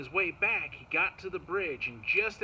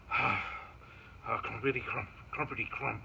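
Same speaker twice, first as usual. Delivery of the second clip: lower, lightly voiced reluctant old man; sigh, then trudge through it a bit lower; grumpy grandpa; 5 semitones lower than usual. Grandpa sent down the hill, mildly grumpy. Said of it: "He wasn't so happy about it".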